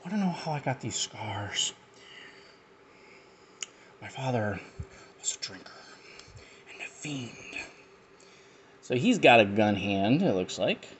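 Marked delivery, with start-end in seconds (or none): 0.0-7.3 Joker voice. Retold or imitated. Heath Ledger Joker quote.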